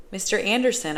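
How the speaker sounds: ordinary delivery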